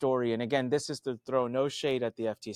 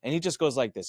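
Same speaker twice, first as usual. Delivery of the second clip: normal talking voice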